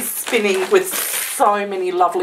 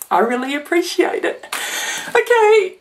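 higher, tearful voice breaking upward; choked up; 9 semitones higher than usual. Real, not acted. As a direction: thank her while fighting back happy tears. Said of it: "I swore I wasn't gonna cry".

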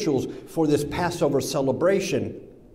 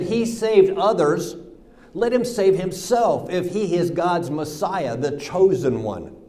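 sneering; 6 semitones higher, 4 dB louder than usual. Acted. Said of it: "the rulers even sneered at him".

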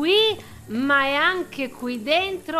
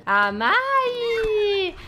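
shouted call; 10 semitones higher than usual. Acted. Player calling out to Amai with a high shout. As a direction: yell her name out high.

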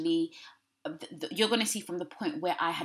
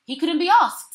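exasperated outburst; 12 semitones higher, 13 dB louder than usual. Real, not acted. Exasperated at ex's excuses.

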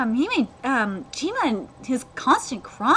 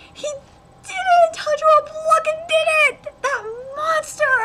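high, thrilled outburst; overexcited fan yelling; ecstatic cheering; 3 dB louder than usual. Real, not acted. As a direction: cry out in amazed triumph. Reacting to hero's success.

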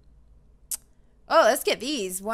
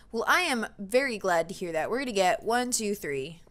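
ordinary talk

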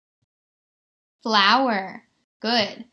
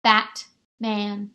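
"Batman" in two beats, BAT, pause, MAN; split into syllables; 2 semitones lower than usual. Shown as a demonstration. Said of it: "there was two syllables".

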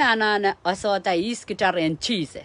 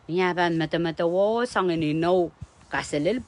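usual speaking voice

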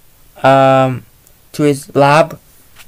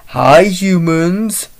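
slow, drawn-out monster hello with a rising end; a lightly voiced giant monster saying hi; a casual monster hello; slow Godzilla greeting; 3 semitones higher, much slower than usual. Godzilla greets the fleeing humans.

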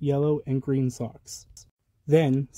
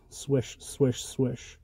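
soft swishes; 2 semitones lower, 6 dB quieter, much slower than usual. A narrator's reading. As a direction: breathe the swishes quietly. Quiet sound words for circling.

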